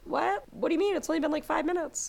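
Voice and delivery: high, incredulous mock aunt; mock Auntie Em. Auntie Em voiced in a retelling.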